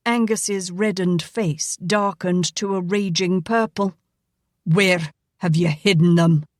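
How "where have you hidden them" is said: angry. A narrator's reading. Raging father demands.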